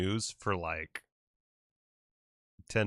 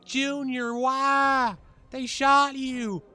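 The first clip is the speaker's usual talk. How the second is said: high, loud, wailing death scene; overacted death scene; 8 dB louder, slower than usual. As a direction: ham up a melodramatic dying farewell. Streamer voices dying bear, mock-dramatic.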